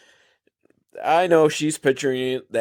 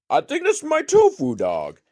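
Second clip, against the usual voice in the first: a much higher, hammed-up bad British accent. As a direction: put on a terrible British accent. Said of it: "in a really bad British accent".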